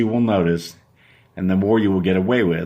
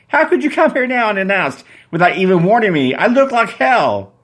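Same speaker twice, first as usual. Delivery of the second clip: high, loud shrieking woman in curlers; Susan shrieking; 9 semitones higher, 4 dB louder than usual. Acted. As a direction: shriek it as the startled girlfriend. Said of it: "She screams when she sees that it's you".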